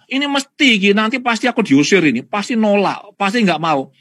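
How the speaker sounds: high, loud, bitter father expecting rejection; father's resentment. Retold or imitated. Father telling the counsellor he'll be rejected, high and bitter. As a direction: spit out the fear of being thrown out. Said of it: "Loh, kenapa kok marah?".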